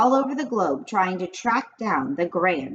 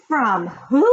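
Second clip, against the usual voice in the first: a quick, louder, raised question; surprised cry; 5 semitones higher, 5 dB louder than usual. A narrator's reading. Boy startled by the Grand High Witch, asks loudly. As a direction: blurt it out in surprise. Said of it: "I cried".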